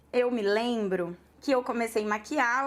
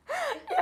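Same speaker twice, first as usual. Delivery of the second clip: giggle; 4 dB quieter than usual. Real, not acted. Laughing at her own eagerness.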